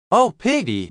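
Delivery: plain reading voice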